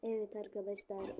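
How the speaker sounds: ordinary talk, nothing marked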